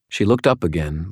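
ordinary delivery

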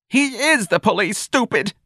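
very high-pitched, angry retort; exasperated shout. Acted. Mrs. Riley correcting her son, exasperated. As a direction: shoot it back, high and exasperated. Mother snapping at threatening son.

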